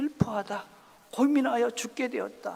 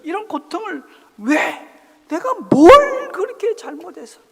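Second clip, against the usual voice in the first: resentful outcry; 7 semitones higher, 13 dB louder than usual. Acted. Believer crying out against God in resentment, building to a shout. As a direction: rail at God, rising to a cry. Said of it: "하나님을 원망할 때가 있죠".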